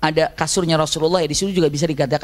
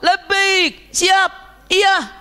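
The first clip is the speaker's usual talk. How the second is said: shouted reply; 12 semitones higher, much slower than usual. Retold or imitated. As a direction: yell back an answer. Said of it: "karena jawabannya teriak".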